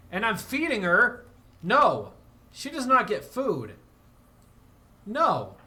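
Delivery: loud, high, indignant yelling; outraged scolding, voice up high; angry shouting. Real, not acted. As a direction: yell at her for freeloading. Scolding the colonist Vive.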